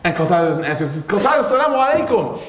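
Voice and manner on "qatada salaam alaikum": high, pleading cry of greeting; crying plea. Retold or imitated. Ka'b pleading with silent cousin Qatada, high tearful cry. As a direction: call out to the cousin, near tears. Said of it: "And he's crying".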